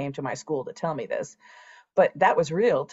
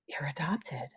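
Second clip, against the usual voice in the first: softly, almost no voice, as if to a mirror; stunned whisper to herself; 9 dB quieter than usual. Retold or imitated. Alone in the shower, telling her reflection, hushed and stunned. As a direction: let the words barely leave you, in shock.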